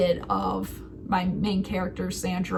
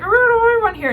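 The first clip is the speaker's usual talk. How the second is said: nervous starfish; 13 semitones higher, 9 dB louder, much faster than usual. Retold or imitated. Starfish quoted from Finding Nemo.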